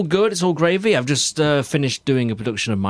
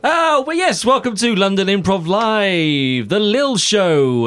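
radio host intro; 6 semitones higher, slower than usual. Acted. Host launches show intro.

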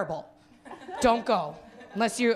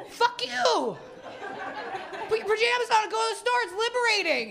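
mock outrage; 10 semitones higher than usual. Real, not acted. Ranting at Toronto audience.

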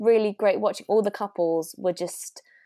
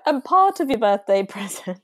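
laughing through the words; repeating her line through laughter; 2 semitones higher, 5 dB louder than usual. Real, not acted. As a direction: giggle while echoing her line.

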